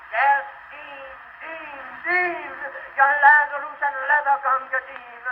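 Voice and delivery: rising passionate climax. Acted. Climactic final tribute.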